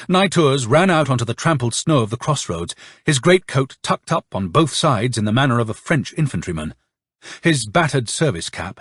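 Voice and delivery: ordinary delivery, nothing marked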